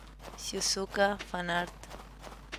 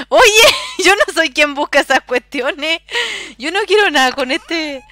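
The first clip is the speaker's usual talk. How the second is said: indignant voice; 6 semitones higher, 6 dB louder than usual. Pretend. Playing a character refusing.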